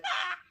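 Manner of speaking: a high, quick excited squeal. Retold or imitated. Friend tells her a gift is coming, excited squeal. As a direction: squeal with delight at the news. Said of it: "and I was like".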